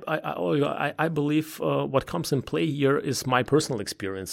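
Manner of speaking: usual speaking voice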